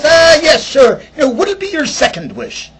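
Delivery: high, loud Irish lilt; Irish leprechaun. Retold or imitated. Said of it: "He goes".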